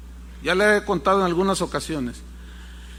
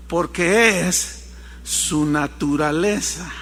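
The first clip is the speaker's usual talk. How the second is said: solemn slow answer; 5 semitones lower, slower than usual. Acted. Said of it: "preacher's answer".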